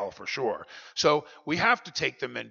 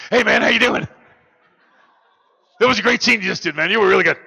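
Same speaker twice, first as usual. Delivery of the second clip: loud, high, hyped-up party guy; druggie on set; 10 semitones higher, 14 dB louder, faster than usual. Retold or imitated. Actor on set greeting him like a wired, loud cokehead. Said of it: "and they're going".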